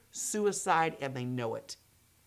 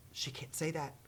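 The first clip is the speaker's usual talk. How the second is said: breathy low gasp then quiet disbelief; hushed shocked thought; 5 semitones lower, 16 dB quieter than usual. Retold or imitated. Friend reading the book, gasping quietly in shock. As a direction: say it under your breath after a gasp. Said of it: "I kept thinking".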